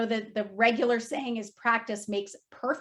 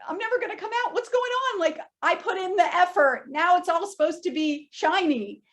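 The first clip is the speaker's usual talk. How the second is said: much higher, whining complaint to the therapist; to her therapist, a high whiny lament; whiny distressed self; 11 semitones higher, 5 dB louder than usual. Retold or imitated. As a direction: plead like a lost child. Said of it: "I went in and I was like".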